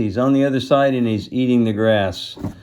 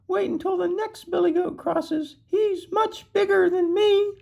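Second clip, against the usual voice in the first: very high, tiny goat voice; high-pitched baby goat bargaining; squeaky little goat. A narrator's reading. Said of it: "so he's got a squeaky voice".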